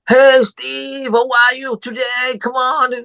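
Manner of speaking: high, cheery wake-up call; overly upbeat morning caller; excited greeting. Retold or imitated. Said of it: "telepon dengan exciting begini".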